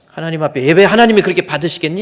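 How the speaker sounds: put-on loud, fast scolding of a child; imitated father's yell. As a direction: rant at the kid like an angry father. (아이에게 빠르게 소리치는 아버지 흉내)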